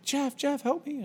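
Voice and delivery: put-on high, pleading child voice; little kid asking for help. Preschooler on the jungle gym calls to Jeff, high pleading kid voice. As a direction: squeak it out like a small boy stuck on the climbing frame. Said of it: "and he's like".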